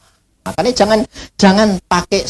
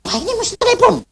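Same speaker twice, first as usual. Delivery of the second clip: high, anxious mock urgency; retold alarm; 13 semitones higher, 3 dB quieter, faster than usual. Preacher tells wife they must phone police, high alarm.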